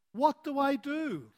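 high-pitched anxious plea; startled, pleading question; shocked. Acted. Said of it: "And he was shocked".

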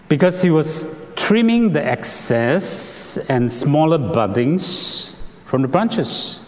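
ordinary talk

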